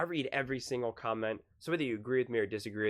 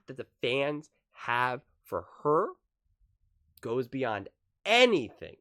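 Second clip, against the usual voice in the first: normal talking voice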